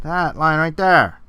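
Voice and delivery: slow, loud, emphatic pointing. Real, not acted. Loud pointing, much louder.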